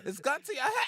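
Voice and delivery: very high voice, HEAD chopped short; high-pitched demo, final word cut after its first sound. Shown as a demonstration. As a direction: squeal the line and break off on HEAD. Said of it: "Try to get the H E at least".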